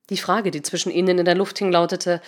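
plain reading voice

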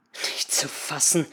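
low, slow, quiet grumble; hushed, disgruntled disbelief; growled disbelief. A narrator's reading. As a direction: grumble it under your breath. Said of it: "knurrte Henry".

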